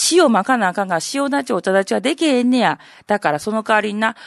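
ordinary talk, nothing marked

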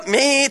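間延びした「メー」の鳴き真似; ぶつかった拍子に出る声を長く伸ばす; ぶつかった時の声「メー」. Shown as a demonstration. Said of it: "ぶつかった時に出る声が". (衝突で漏れる「メー」という声)

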